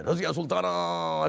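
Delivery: imitating a grand theatre actor's cry; theatrical stage cry. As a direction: declaim the name, stretching the last vowel. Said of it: "ऐसा सब, मुझे सब याद है".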